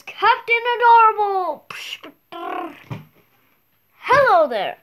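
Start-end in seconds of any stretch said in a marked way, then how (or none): 0.0-1.7 loud, high, proud superhero intro; hero announcement. Pretend. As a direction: announce yourself like a cartoon hero. Child announces superhero name.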